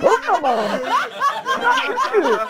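loud, laughing protest; exasperated laughing. Acted. Teased friend protesting while laughter fills the room. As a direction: complain through the laughter.

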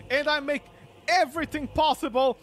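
usual speaking voice

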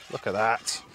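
ordinary talk

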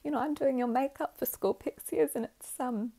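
acted tearful gushing, loud against the hush; getting emotional. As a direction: play a mother overwhelmed and apologising, nearly crying. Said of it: "I'm getting a little bit emotional".

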